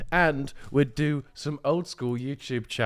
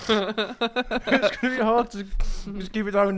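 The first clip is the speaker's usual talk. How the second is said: laughing; 8 semitones higher than usual. Real, not acted. Laughing mid-game.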